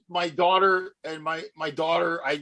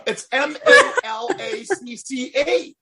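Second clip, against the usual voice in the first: much higher, slow spelling through laughter; laughing spell-out; 12 semitones higher, 3 dB louder than usual. Real, not acted. Spelling Malacca for friends while laughing.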